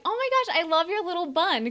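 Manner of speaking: high, gushing praise; gushing compliment. Retold or imitated. Singer praising the fan's bun, high gushing. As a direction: coo over her hair.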